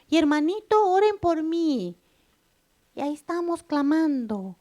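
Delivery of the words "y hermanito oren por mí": higher, louder, slowed plea for prayer; mock pleading believer. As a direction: mimic a lazy churchgoer begging others to pray. Mocking believers begging for prayer. (imitando con burla a una hermana que pide oración)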